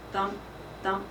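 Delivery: usual speaking voice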